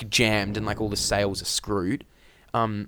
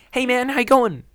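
high, mock Kiwi accent; hammed-up New Zealand twang; broad Kiwi accent; 14 semitones higher, 6 dB louder than usual. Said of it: "He wasn't Kiwi".

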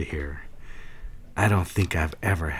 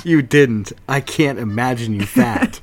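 loud, higher cry of disbelief; amazed disbelief; 6 semitones higher, 7 dB louder than usual. Acted. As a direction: gasp it out, delighted and shocked. Surprised reaction to her story.

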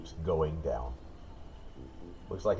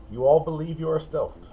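teasing; 8 semitones higher, 5 dB louder than usual. Acted. DM teasing failed stealth.

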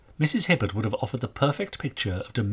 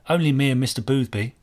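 put-on boy's voice; 3 semitones higher, 4 dB louder than usual. A narrator's reading. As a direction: voice him as a plain-spoken boy. Boy voiced answering the inspector.

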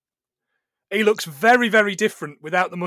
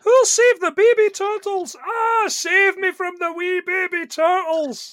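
much higher, loud hammed-up wail; mock panic; 12 semitones higher, 4 dB louder, slower than usual. Joking about baby turtles with a friend, hammed-up panicked squeal. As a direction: squeal in fake alarm, slowly on the second line.

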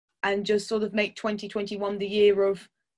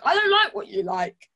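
whiny other person; 8 semitones higher than usual. Retold or imitated. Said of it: "other people will be like".

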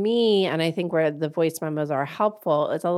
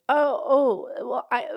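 flustered; 7 semitones higher than usual. Retold or imitated. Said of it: "I'm kind of fumbling".

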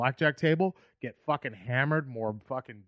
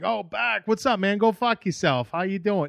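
higher, friendly Boston greeting with a casual curse; Boston buddy greeting; 6 semitones higher, 3 dB louder than usual. Retold or imitated. Bostonian greeting an old friend, cheerful Boston accent. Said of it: "when you live in Boston".

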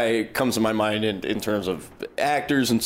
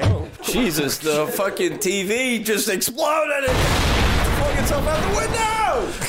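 panicked yell; 12 semitones higher, slower than usual. Acted. TV explodes in improv.

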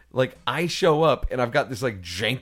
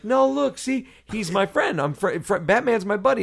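put-on raised, eager kid-friendly voice; high-pitched reassurance; 7 semitones higher than usual. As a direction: talk to scared little kids, voice lifted. Reassuring scared kids about Batman.

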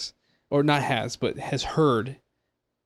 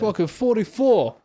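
put-on higher Boston-accented outburst; 7 semitones higher than usual. Co-host chiming in with an attempt, Boston accent. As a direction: blurt it in a mock Boston accent. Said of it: "uh, Boston".

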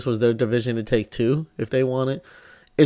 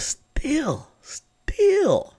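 much higher, strained exasperation; exasperated; 12 semitones higher, much slower than usual. Real, not acted. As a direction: squeak it out in frustration. Exasperated high voice.

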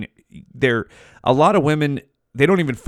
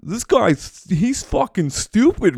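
high, mocking imagined viewer; mock critic; 9 semitones higher than usual. Imagined viewer ridiculing his veins analogy, high and mocking. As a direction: sneer it back as a scoffing critic. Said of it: "don't fucking sit here and be like".

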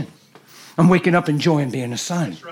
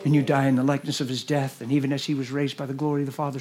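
ordinary delivery

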